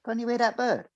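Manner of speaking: put-on high, soft childlike inner voice; little Johnny. His inner child Johnny notices the dawn birdsong, soft and high. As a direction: gently point out the birdsong like a small child. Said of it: "little Johnny comes along and goes".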